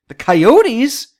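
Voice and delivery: loud, rising disbelief; can't believe it's them; disbelieving. Real, not acted. Repeats in disbelief.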